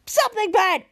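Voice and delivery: sudden loud high outburst; loud blurted threat. Retold or imitated. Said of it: "blurted out".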